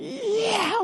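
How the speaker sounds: high, drawn-out mock yell; a long high "yeah" as he shoots past; imitated flying yell. Retelling a skater flying downhill, high mock yell.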